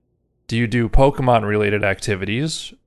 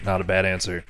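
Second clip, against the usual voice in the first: quiet; 5 semitones lower, 7 dB quieter, faster than usual. Shown as a demonstration. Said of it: "repeated take".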